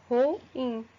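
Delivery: "ruim" split clearly as ru-IM; correct pronunciation. Shown as a demonstration. Teacher modelling the correct form, vowels clearly separated. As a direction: separate U and I in "ruim", two syllables. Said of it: "a pronúncia correta de ruim é dessa forma".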